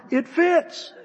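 very high, loud exclamation; astonished discovery. Acted. Richard checking his own wrench, astonished that it works. Second wrench also fits, story climax.

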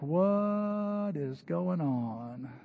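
slow, drawn-out puzzled murmur; bewildered. Retold or imitated. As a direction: stretch it out like you can't believe your eyes. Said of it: "everybody's kinda like".

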